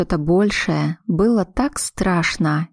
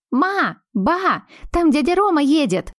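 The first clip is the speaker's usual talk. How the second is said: put-on much higher, excited little girl's call; child calling out; 9 semitones higher than usual. Granddaughter calling mother and grandmother, high excited child. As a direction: shout the news like an excited kid. Little girl calls from outside.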